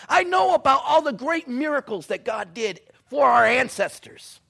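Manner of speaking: loud, high impassioned preaching; preacher voicing Gideon's protest; preaching at full force. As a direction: pour out Gideon's grievance.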